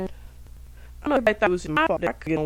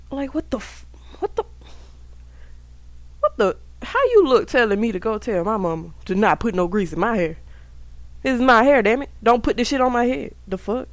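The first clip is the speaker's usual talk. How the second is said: higher and louder, offended disbelief at the teacher; indignant outburst; 4 semitones higher, 3 dB louder than usual. Retold or imitated. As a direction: snap back as if insulted about your own hair. Outraged retort to teacher's remark.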